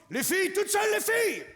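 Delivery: high rallying shout to the women; calling out. Real, not acted. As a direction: whip up the women in the crowd.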